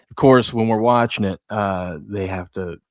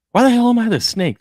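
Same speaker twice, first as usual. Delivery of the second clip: put-on high, loud whiny kid outrage; mock-whiny sister complaining about being the snake; indignant little sister; 14 semitones higher, 6 dB louder than usual. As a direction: squeal it like an offended little girl. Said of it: "And she's like".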